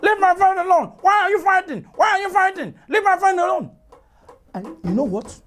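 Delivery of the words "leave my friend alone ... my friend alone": tortoise shouts to squirrel to leave his friend; tortoise yelling. Acted. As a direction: yell at them to stop fighting.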